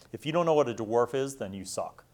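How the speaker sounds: ordinary talk, nothing marked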